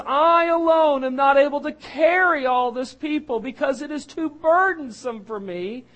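high, loud, slow anguished plea; dramatic Moses lament. Acted. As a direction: cry out to the Lord as a burdened servant. Reading Moses's complaint dramatically.

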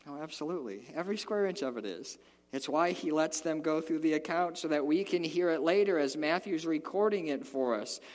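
ordinary talk

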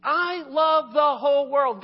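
loud, slow and emphatic; big preacher's declaration; booming proclamation. Acted. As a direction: proclaim it to the whole world. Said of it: "his teaching is".

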